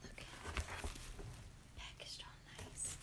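soft unvoiced whisper; hushed whisper over the box. Real, not acted. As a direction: whisper it like a secret. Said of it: "I don't know why I'm whispering".

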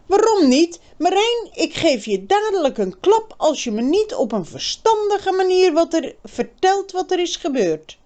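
put-on girl's voice, angry and pushing. A narrator's reading. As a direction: scold him as the furious girl. Birgit threatens angrily.

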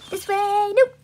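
usual speaking voice

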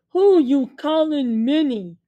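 loud, slow indignant snap. A narrator's reading. Josh snapping at Willie for calling him mini, loud. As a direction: snap it back, offended. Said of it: "Josh snapped".

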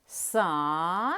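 Са-ни in two drawn-out, separate syllables; split into syllables. Shown as a demonstration. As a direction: pause between Са and ни, stretching each. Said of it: "Поділимо слово на склади". (Са-ни по складах, протяжно)

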